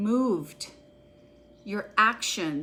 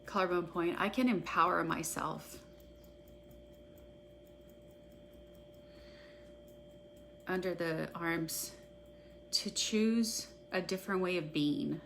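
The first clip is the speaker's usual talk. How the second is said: a guided EFT tapping script read for followers; guided tapping meditation; 3 semitones lower, 9 dB quieter than usual. Acted. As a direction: call each tapping point, then the affirmation, at an even unhurried pace. Leads a guided tapping session.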